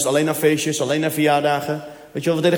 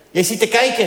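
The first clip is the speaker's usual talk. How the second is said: higher foreign cleaner voice; cleaner's voice; 5 semitones higher than usual. Retold or imitated. Claudia catching the husband staring, higher put-on voice. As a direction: catch him staring, pitched up.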